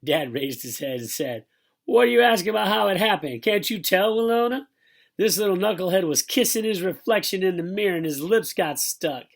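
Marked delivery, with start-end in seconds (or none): 1.8-9.2 loud, high-pitched mocking dad; loud incredulous ribbing; exasperated outburst. A narrator's reading. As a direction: crow it out in disbelief. Amused Dad's exasperated outburst, acted.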